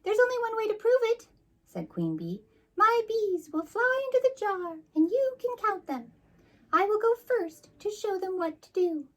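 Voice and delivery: very high haughty bee queen. Acted. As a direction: look down on the jar in a high bee voice.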